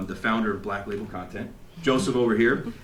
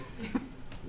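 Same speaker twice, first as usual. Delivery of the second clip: soft laugh; 13 dB quieter than usual. Retold or imitated. Laughing at own cockiness.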